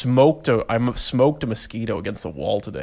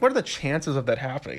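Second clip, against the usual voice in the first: ordinary talk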